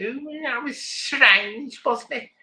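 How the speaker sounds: high, very slow, drawn-out mock voice; mock puzzled viewer. Viewers reacting to her ASMR, high and drawn-out mockery. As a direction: sing it up high like a baffled viewer. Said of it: "other people saying".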